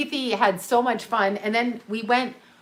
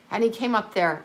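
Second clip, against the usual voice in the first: normal talking voice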